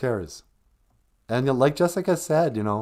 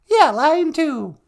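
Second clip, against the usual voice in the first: much higher, louder and slow; partner voice; 13 semitones higher, 7 dB louder, slower than usual. Pretend. Co-host confirming the caller line, high and drawn out. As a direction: stretch it out high and loud.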